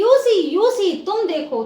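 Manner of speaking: high, loud, triumphant cry; excited boast. Acted. Derry tells Mr Lamb he came back, excited loud boast. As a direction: crow it out, proud to be back.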